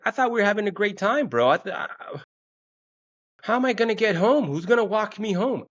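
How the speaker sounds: high-pitched, hammed-up pleading; panicky protest. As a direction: plead in a high voice as you're being ditched. Acted pleading as date walks out.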